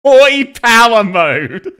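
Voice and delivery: loud, drawn-out fake Australian "Hoi"; mock Australian accent. Streamer mocks the played line, exaggerated fake Australian accent. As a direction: parody the bad Australian accent, high and slow.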